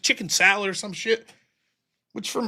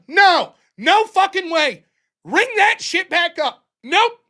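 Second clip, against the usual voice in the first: loud, much higher, slow flat refusal; angry refusal; 9 semitones higher, 5 dB louder, slower than usual. Retold or imitated. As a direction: shut the customer down hard. Refusing a customer angrily.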